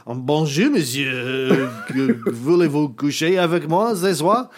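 lightly voiced French phrases, cheeky; a hint of a French accent, playing the smooth tourist; mock French. Podcaster joking how Americans approach the French, mock French. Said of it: "we walk up and we say".

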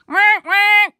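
high honking wail; imitated tour noise. Mimicking Viking Splash Tour passing by, high honking noise. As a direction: honk out two loud, high wahs. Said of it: "I just go by them going".

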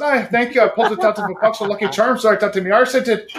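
fast, cheeky Irish brogue answering the husband. Retold or imitated. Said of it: "never to apologize for the Irish brogue".

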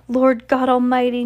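high, loud cry of alarm; a frightened aunt crying out. A narrator's reading. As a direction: gasp it out in shock. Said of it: "Nellie cries".

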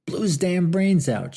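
high, loud shout. A narrator's reading. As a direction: another drunk hollers the charge. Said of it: "shouted another".

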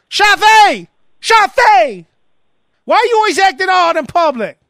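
high, loud imitation of a yelling mother; hammed-up public telling-off; mock scolding mama. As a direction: holler the kid's name across the line. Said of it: "Chavez' mama".

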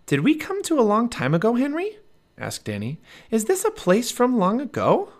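put-on high, wondering young girl; little girl voice. A narrator's reading. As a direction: ask in a high, curious girl's voice. Narrator voices Annie.

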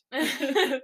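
light giggle. Real, not acted.

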